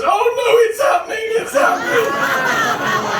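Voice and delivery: very high comic panic, last word stretched; hammed-up high-pitched panic; panicked shout. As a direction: shriek it as the dreaded trouble arrives. Customer's panicked punchline of the joke.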